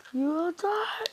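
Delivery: high voice climbing, kept quiet; soft, rising high-pitched menace; acted threat. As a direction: threaten softly with pitch sliding upward. Naruto threatens foe, voice rising high.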